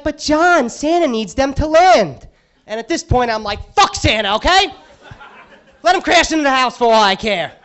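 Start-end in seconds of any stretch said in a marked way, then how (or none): 0.0-2.3 high, slow, pleading singsong; louder, sweet scolding reason; mock pleading. Wife pleading about lights. 3.7-7.5 louder, exasperated rant; louder, fed-up retort with a laugh; mock outburst. Frustrated retort over lights.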